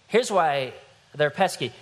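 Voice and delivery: usual speaking voice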